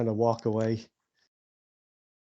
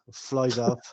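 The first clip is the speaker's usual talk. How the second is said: normal talking voice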